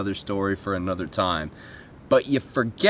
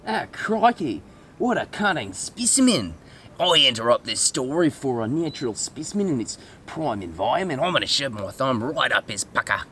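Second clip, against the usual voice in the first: mock Australian wildlife host; 2 semitones higher than usual. Wildlife host spotting a specimen, mock Australian accent. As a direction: marvel in broad Australian.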